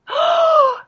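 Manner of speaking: high shocked gasp; horrified gasp. Retold or imitated. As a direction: gasp in horror. Father horrified.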